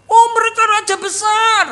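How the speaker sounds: high, pleased voice of a king showing off; proud boasting king. Retold or imitated. Preacher voicing the boastful king Hezekiah answering Isaiah, high and proud.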